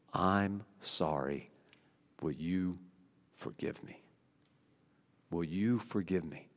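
hushed, humble plea for forgiveness; soft apology. Pretend. Preacher voicing a confession to a spouse, softly pleading.

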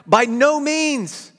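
drawn-out stressed no; emphatic slow. Shown as a demonstration. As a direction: stretch By no means with a strong no.

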